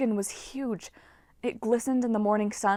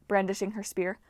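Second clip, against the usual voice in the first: plain reading voice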